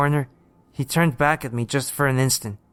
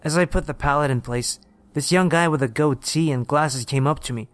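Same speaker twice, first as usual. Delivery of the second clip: usual speaking voice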